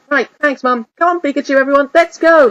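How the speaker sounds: rally the team and run off; eager rallying boy. Acted. Ash rallying his team.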